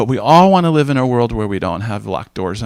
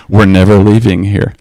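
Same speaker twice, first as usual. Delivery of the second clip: imitating wife; 4 semitones lower, 7 dB louder than usual. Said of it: "That's how she is".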